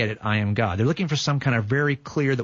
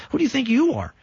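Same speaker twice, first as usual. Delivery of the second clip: indignant challenge; 13 semitones higher than usual. Acted. Crowd challenging Jesus, shouting high and indignant. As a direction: hurl the question at him in outrage.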